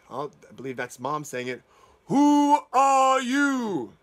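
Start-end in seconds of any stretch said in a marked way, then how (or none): 2.1-3.9 a very slow, very loud, higher roar; roaring mother dragon. Acted. Mother dragon roaring at the wolf, very slow and loud. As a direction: roar the question, drawn right out.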